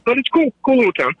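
ordinary delivery

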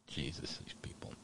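whispered exasperation; exasperated whisper. Real, not acted. Host, fed up with the slogans, whispers in exasperation. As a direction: breathe it out, fed up.

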